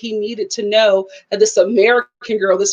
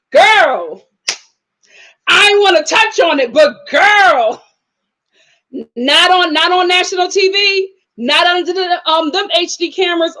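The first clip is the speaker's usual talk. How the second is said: sassy loud; 5 semitones higher, 5 dB louder than usual. Real, not acted. Vlogger sassily tells Brittany not on TV, loud and emphatic. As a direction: call out to her sister like a friend. Sassy scolding rant to viewers.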